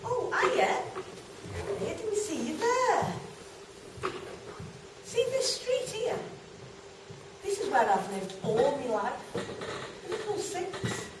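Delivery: put-on lively, swooping storytelling in a young girl's role; chatty young girl character. Girl showing the audience her street and mates, chatty and animated. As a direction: chat brightly to the audience as a girl reminiscing.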